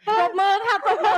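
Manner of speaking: high, bright call for applause; rally everyone to clap; excited call. Real, not acted. Cheering crowd to applaud.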